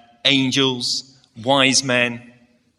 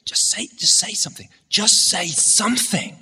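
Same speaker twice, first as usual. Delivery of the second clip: pleading stage whisper, higher; teacher urging Joseph to say anything in a whisper; urgent whisper; 6 semitones higher than usual. Retold or imitated. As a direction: whisper it, begging him to speak. Teacher prompting from the wings.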